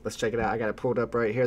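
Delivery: ordinary delivery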